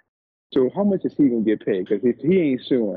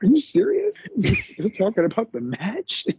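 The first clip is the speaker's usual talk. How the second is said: very high incredulous squeal; mock Booker's shock that they talk about the match; high-pitched disbelieving Booker; imitating Booker; 11 semitones higher than usual. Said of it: "Booker's like".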